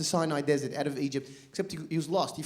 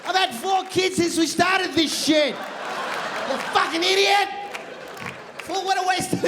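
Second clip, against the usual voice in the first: higher, louder ranting at Moses; fed-up Israelite; 8 semitones higher, 4 dB louder, slower than usual. Retold or imitated. Israelite after decades lost, yelling at Moses. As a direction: yell in exasperation at the lost leader. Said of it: "Started saying things like".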